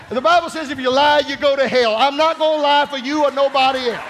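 loud, high preaching cry; loud, high impassioned prayer from the pulpit; full-force preaching. Acted. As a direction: preach it out with fire.

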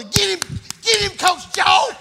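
high, laughing sideline cheer; mock cheering parent. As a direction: egg the coach on from the stands.

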